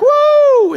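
very high, loud triumphant whoop; joyful whoop. Retold or imitated. Son surfacing to his dad, joyful whoop. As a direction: let out a thrilled cheer.

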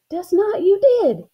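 put-on high, loud childish contradiction; squabbling. A narrator's reading. Girl arguing with her sister, squabbling. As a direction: fire back a stubborn accusation.

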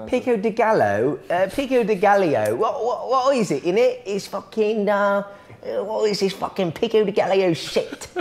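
a hammed-up English grump; British accent. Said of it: "speak English like this".

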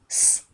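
S as a pure voiceless hiss; letter sound demo. Shown as a demonstration. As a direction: sound out S as "sss", no vowel. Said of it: "diye okunur". (S'yi "sss" diye tısla)

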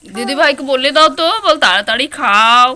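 put-on high, loud sing-song coaxing the baby; loud, lilting baby talk to the infant. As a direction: coo brightly up at the little one. Mother coos at the baby.

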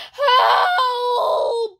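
high, drawn-out panicked cry; long hammed-up distress call; yelling for help. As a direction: wail it out desperately. Victim yells for help.

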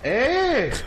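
loud shout. Real, not acted. Sudden protest at teammate.

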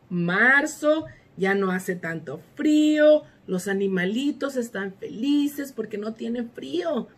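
normal talking voice

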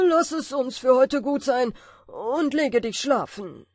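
loud, nervous giant. A narrator's reading. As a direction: hurry to end it, rattled giant. Scared giant ends the day. (nervöser Riese)

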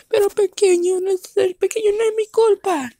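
high, whiny protest; pleading little dog. Acted. Small dog pleads it is not its fault. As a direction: beg not to be thrown out.

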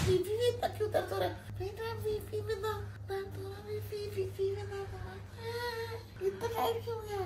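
very high, soft mock-crying whine; whiny crying baby voice. To her friend while the BP cuff squeezes, high mock-crying whine. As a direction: whimper like a hurt child. Whining about BP cuff pain.

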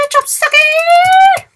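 very high, slow, stretched silly cry; high drawn-out goofy yelp; silly cartoon voice. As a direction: squeal it like a cartoon critter. Silly exclamation after close call.

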